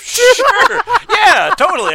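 loud, sarcastic high "sure". Real, not acted. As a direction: wave it off in loud disbelief. Sarcastic disbelief.

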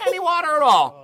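high, emphatic; voice goes high. Real, not acted. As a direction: let the voice jump up high. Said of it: "My voice changed".

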